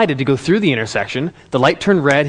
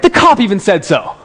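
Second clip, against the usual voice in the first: put-on louder, higher teen insistence; proud self-vindication; 5 semitones higher, 5 dB louder than usual. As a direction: clinch it like a vindicated kid. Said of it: "He's all proud".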